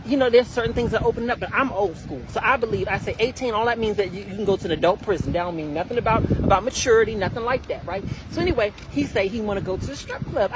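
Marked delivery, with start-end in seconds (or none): none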